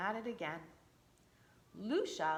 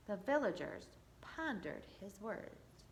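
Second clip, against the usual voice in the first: ordinary delivery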